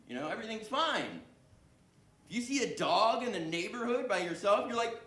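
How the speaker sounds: ordinary talk, nothing marked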